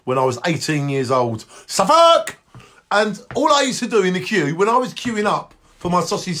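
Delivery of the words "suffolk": high loud yell; shouting Suffolk. Acted. Host yelling his catchphrase at viewers, loud. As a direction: bellow it out.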